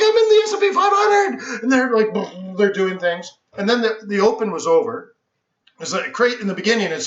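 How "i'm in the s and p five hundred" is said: much higher, loud and gushing; retold giddy squeal in the pit. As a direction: squeal it like a dream come true. Thrilled standing in the big pit.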